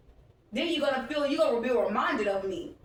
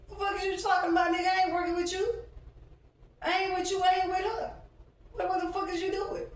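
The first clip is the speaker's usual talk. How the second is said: indignant outburst; 8 semitones higher, faster than usual. Retold or imitated. Angry rant at passersby.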